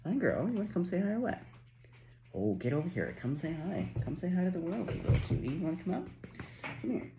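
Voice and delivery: cooing at a pet dog to come say hi; coaxing dog voice. Real, not acted. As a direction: coax the puppy over to you. Coaxing her dog Georgie over.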